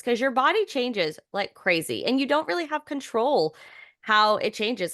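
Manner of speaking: ordinary talk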